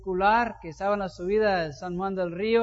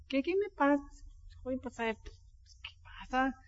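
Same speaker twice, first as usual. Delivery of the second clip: very soft, almost breathless self-questioning; hushed remorse; 3 semitones higher, 11 dB quieter than usual. Retold or imitated. Pastor quietly asks the Lord what came over him. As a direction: ask yourself quietly what went wrong.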